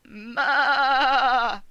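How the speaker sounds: loud far-off sheep cry; loud bleat. Acted. Sheep next door answering, loud bleat.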